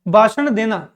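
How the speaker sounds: normal talking voice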